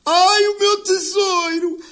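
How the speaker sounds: high, drawn-out mock wail of passion; imitating her cries. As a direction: mimic her crying out, building to a loud finish. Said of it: "a gritar assim". (imitação de gritos agudos, em crescendo)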